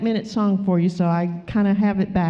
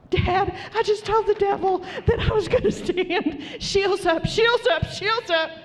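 put-on high, excited kid reporting to Dad, laughing; very high playful voice, bubbling over; gleeful boasting; 11 semitones higher than usual. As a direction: squeal it with giddy, hammed-up pride. Acts gleeful child telling God.

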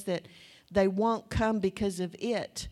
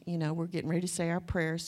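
normal talking voice

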